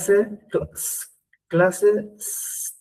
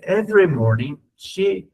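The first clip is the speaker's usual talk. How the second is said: slow model reading; slower than usual. Shown as a demonstration. Teacher reads the English example slowly to the class. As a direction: read slowly, word by word. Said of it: "Entonces dice".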